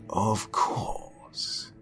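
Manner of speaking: "Of course" breathed in a voiceless whisper; the artist answering the boy softly while studying the girl. A narrator's reading. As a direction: say "Of course" quietly, almost to yourself.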